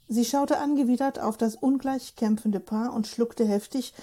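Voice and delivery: plain reading voice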